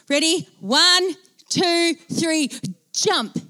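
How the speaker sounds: high, suspenseful count; tense countdown. Retold or imitated. Two trapped colleagues about to jump, high tense countdown. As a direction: count up to the big moment, pitched high.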